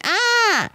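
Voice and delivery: high mock frustrated shriek; retold scream. Daughter giving up on the can, mock shriek. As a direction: yelp like a fed-up kid. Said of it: "She screamed".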